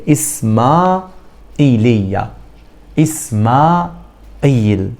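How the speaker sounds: إسماعيلية with the vowels kept apart, إسماعيل drawn out slowly; separated vowels. Shown as a demonstration. Teacher to students, modelling the break in إسماعيلية. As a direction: stretch إسماعيل so each vowel stands alone. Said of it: "يعتبر باجي في وقفة صوتية".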